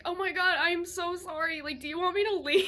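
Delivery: high, loud, flustered apologizing; retold panicked apology. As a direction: imitate an alarmed boyfriend pleading to make amends. Boyfriend alarmed by her crying.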